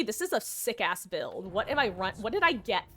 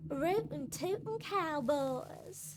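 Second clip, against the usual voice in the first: slow, higher hammed-up cowboy accent; 6 semitones higher, 3 dB quieter, much slower than usual. Shown as a demonstration. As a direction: ham up a Wild West cowboy. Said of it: "the more cowboy-esque accent".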